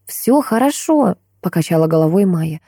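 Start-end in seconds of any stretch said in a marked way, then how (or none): 0.0-1.2 higher, unhurried daughter's voice; slow, high-pitched reassurance; reassuring daughter. A narrator's reading. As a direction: soothe him in a higher voice, drawn out. Daughter reassures her shaken father.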